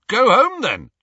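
angry dismissal. Acted. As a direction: fling it at him in anger. Harry snaps at Ron.

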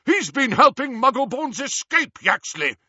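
high, loud accusation; shouting. A narrator's reading. Harry as Runcorn accuses the wizard, shouting. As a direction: shout it urgently across the hall. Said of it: "Harry shouted".

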